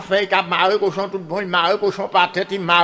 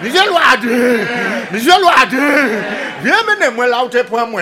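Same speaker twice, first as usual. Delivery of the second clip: pig calling out; 4 semitones higher, 5 dB louder than usual. Acted. Pig calls to the hunter. (le cochon appelle le chasseur, haut, fort et lent)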